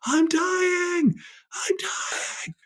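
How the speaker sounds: high, strained cry of help breaking into a whisper; reenacted terrified scream. On the ceremony floor, reliving his death, a high panicked cry. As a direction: scream for help as if dying, then fade to a broken whisper. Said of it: "yelling at the top of my lungs, screaming".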